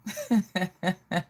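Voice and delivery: short burst of giggles; laughing. Real, not acted. Vlogger amused by her own patois greeting, laughing. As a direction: laugh at your own silly bit.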